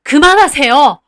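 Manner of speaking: loud cry to stop; mother shouting to stop the grandmother. Retold or imitated. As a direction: yell it from the kitchen. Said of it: "소리를 지르셨어요".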